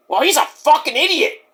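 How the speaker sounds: high, exasperated put-on voice; Mike. Wrestler dismissing his rival to the host, high impression. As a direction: throw out the insult, pitched well up. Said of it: "You did, Mike".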